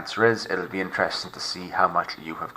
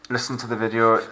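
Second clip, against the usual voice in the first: usual speaking voice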